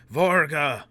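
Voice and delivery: high, very loud shout. Acted. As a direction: yell the name defiantly. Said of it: "Foyle shouted".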